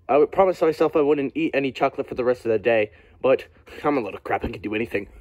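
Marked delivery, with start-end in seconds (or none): none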